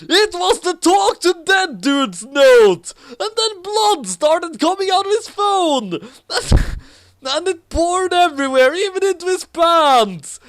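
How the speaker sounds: high, loud, breathless storytelling; frantic climax. A narrator's reading. Storyteller shouting the bloody climax at full force.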